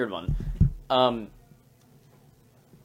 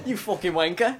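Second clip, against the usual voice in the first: higher, mock British insult; British accent; 7 semitones higher, 4 dB louder than usual. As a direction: spit it like a cockney. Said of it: "That's really good".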